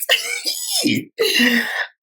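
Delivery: a high shrieking burst of laughter. Real, not acted. Host cracking up at the three-year season line, shrieking laugh.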